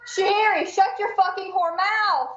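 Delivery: sharp yell at the cat. Real, not acted. Streamer yells at her cat on a hot mic. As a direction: scold the cat without knowing the mic is live. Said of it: "That just went over the air".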